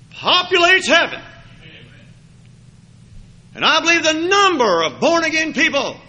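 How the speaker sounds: loud fervent preacher voice; preaching. Acted. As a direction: drive it home from the pulpit. Preaching at full force.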